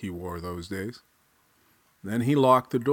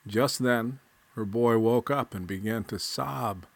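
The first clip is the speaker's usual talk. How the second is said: ordinary delivery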